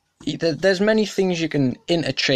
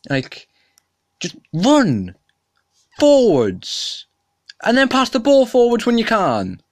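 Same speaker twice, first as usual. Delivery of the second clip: higher and louder, real exasperation; vexed shouting; 10 semitones higher, 4 dB louder than usual. Real, not acted. Venting to viewers about rugby, vexed shout. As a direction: yell the obvious answer at the screen. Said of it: "I'm getting very vexed".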